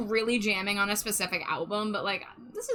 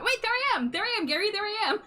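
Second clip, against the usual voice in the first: excited; 12 semitones higher, faster than usual. Real, not acted. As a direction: shriek with delight at spotting yourself. Spots herself in video.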